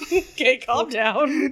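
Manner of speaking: ordinary talk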